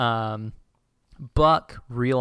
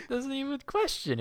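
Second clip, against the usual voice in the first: real laughter pushing the voice much higher; laughing while talking; 12 semitones higher than usual. Real, not acted. Podcaster retelling the guard handing over his gun, helpless laughter. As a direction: crack up helplessly through the words. Cracking up retelling absurd scene.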